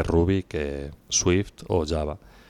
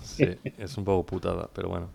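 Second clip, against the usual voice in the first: rough, hoarse from coughing; 7 dB quieter than usual. Real, not acted. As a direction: speak through a hoarse throat. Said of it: "tengo la voz ronca".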